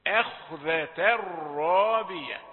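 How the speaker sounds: slow, loud "أخذة" with thin a, dark kh; light hamza, heavy kha. Shown as a demonstration. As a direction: draw out "الرابية" after a light "أخذة". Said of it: "الهمزة رقيقة والخاء مفخمة". (ترتيل "أخذة" بهمزة رقيقة وخاء مفخمة)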